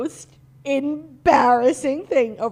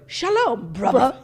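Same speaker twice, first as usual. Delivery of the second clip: louder mock Hebrew-British greeting; Hebrew with bruvva; 4 dB louder, slower than usual. Teasing co-host's accents, loud mock Hebrew and British. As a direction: Hebrew hello, then British bruvva with a laugh. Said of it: "You could do Hebrew".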